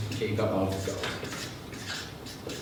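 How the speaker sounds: very slow, drawn-out whisper; hushed secret. Shown as a demonstration. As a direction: whisper it like hidden treasure. Introduces a secretive archetype.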